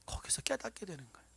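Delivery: ordinary delivery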